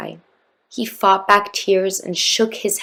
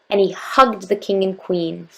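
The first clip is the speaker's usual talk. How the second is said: plain reading voice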